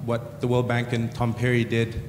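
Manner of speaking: normal talking voice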